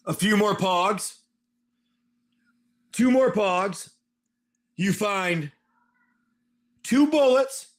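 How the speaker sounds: usual speaking voice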